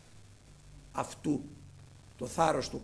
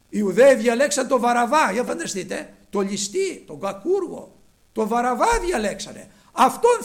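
loud, emphatic, raised preacher's voice; full-force preaching; 6 semitones higher, 12 dB louder than usual. Real, not acted. Preacher telling how they chose Barabbas, loud preaching. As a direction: drive home the shocking choice.

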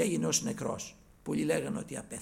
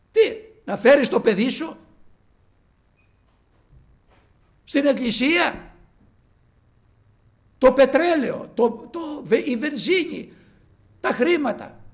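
louder, higher fervent exhortation; preaching at full force; 9 semitones higher, 14 dB louder than usual. Acted. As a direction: preach it out to the congregation. (δυνατό κήρυγμα)

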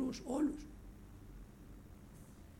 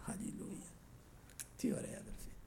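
soft breathless whisper; whispered praise; 2 semitones lower, 13 dB quieter than usual. Real, not acted. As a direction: whisper it in awe. (ψιθυριστά)

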